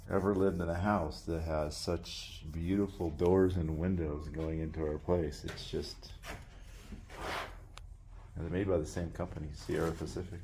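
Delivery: soft, moved, words tailing off; real choked-up emotion, hushed. Real, not acted. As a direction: hold back tears as you speak. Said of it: "I'm kinda getting choked up here".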